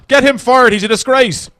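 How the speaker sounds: high, loud mock indignation; mock outrage. Comic demanding the gas man be fired, high and loud. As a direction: cry it out like an offended customer.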